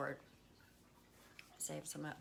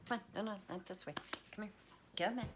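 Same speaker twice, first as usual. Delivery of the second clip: usual speaking voice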